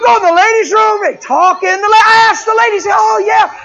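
loud, high, laughing through every word; laughing loud storytelling. Real, not acted. Preacher telling the church a funny story, laughing loudly. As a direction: howl it out to the crowd, cracking up.

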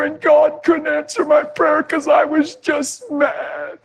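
wife confesses through tears; crying confession. Retold or imitated. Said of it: "looked me right in the eyes and said".

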